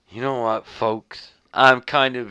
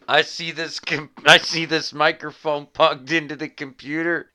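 ordinary talk

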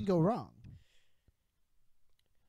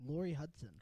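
usual speaking voice

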